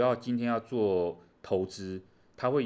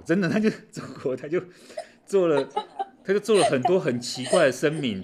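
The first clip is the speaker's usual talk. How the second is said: laughing, louder and higher; 8 semitones higher, 8 dB louder than usual. Real, not acted. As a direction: laugh through the disbelief.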